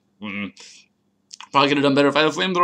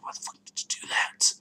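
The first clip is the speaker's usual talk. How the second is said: hushed, much softer disbelief; exasperated mock whisper; 11 dB quieter than usual. Player questioning opponent's Substitute, exasperated mock whisper. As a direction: whisper it as a retold complaint.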